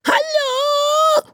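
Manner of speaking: very high, piercing squeaky cry for help; tiny creature's desperate shout. Acted. Tiny slipper creature calling out to the man above, piercing squeaky shout. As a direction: yell with all your might, a tiny creature trapped in a slipper. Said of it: "schrie er mit aller Kraft".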